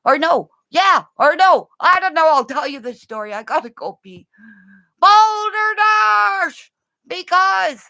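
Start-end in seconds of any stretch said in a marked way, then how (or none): 5.0-6.7 Robin Williams yell. Retold or imitated. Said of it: "Robin Williams enters my body".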